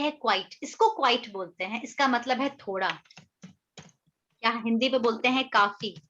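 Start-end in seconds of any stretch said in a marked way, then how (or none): none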